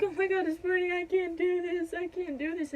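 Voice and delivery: high, wailing re-enactment of panic; retold labor panic. Retold panic at burning during birth.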